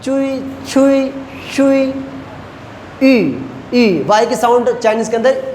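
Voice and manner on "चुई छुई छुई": चुई, छुई said slowly and carefully as Chinese syllables; slow careful demo of चुई and छुई. Shown as a demonstration. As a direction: sound out चुई, छुई like a teacher. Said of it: "narrow में पढ़ना होता है".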